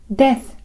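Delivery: death ending in a voiceless th, tongue between teeth; English th. Shown as a demonstration. Teacher demonstrating English th, voiceless dental fricative. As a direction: let the th of death hiss out. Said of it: "英語のth".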